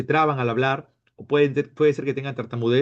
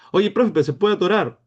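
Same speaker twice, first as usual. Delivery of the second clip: high, loud mock pupil; imitated pupil voice, pitched up and louder; student interrupting; 6 semitones higher, 5 dB louder than usual. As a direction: butt in like a concerned student. Teacher voices a worried student question.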